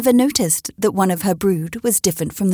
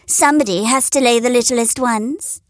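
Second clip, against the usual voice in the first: high, sugary mock consolation; high hen voice teasing; 9 semitones higher than usual. A narrator's reading. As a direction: console her with a sting. Neighbour's jibe at Henrietta.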